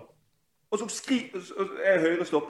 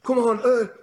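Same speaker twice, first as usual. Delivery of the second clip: loud, higher imitation of coaches yelling; mock sideline yell; 7 semitones higher, 6 dB louder than usual. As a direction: parody a senseless touchline shout. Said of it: "sånn der skrik fra sidelinjen". (hånlig etterapet skrik fra sidelinjen)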